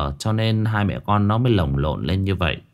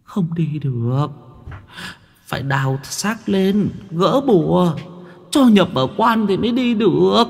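much higher tearful ghost woman's voice; whimpering ghost; 9 semitones higher than usual. A narrator's reading. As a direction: plead through tears, voice breaking. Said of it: "Hồn ma kia mếu máo". (giọng ma nữ mếu máo)

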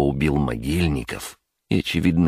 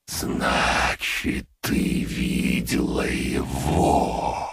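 slow, pondering, half whispered; dark lord; much slower than usual. A narrator's reading. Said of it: "негромко и задумчиво произнёс Лич".

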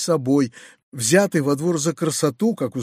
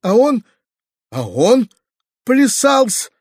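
high, louder woeful lament; plaintive; 6 semitones higher, 4 dB louder, slower than usual. A narrator's reading. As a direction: stammer out the complaint in a high wail. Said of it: "отвечал Гаврила плачевным голосом". (плачевным голосом, высоко)

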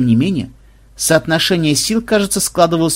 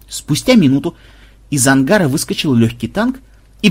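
ordinary delivery, nothing marked

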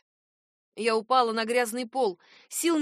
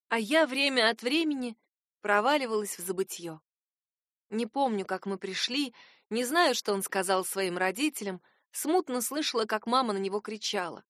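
plain reading voice

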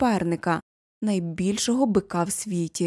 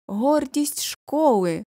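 high, slow, ringing call; proud shout; 6 semitones higher, 4 dB louder, much slower than usual. A narrator's reading. Headmaster hails the school's pride, shouting. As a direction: call it out grandly, stretching each word. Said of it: "кричить директор".

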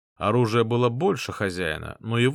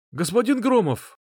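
shouted, much higher; calling out; 11 semitones higher, 5 dB louder than usual. Acted. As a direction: yell across the battlefield. Soldier calls out in battle.

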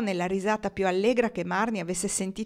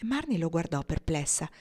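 ordinary delivery

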